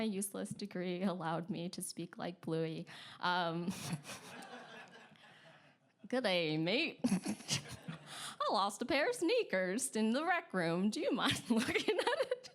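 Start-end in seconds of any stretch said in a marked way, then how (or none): 6.1-12.5 playful, hammed-up Australian accent with laughs. Hostel guest phones about lost sneakers, mock Australian accent. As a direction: imitate an Australian caller, cracking up at your own accent. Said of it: "I'm gonna stop with the accent".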